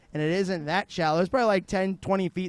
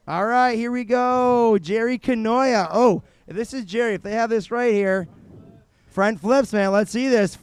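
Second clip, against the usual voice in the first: raised, drawn-out announcer build-up; live play-by-play; 5 semitones higher, 5 dB louder than usual. Real, not acted. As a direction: introduce the next run with rising anticipation. Announces the next skier's run.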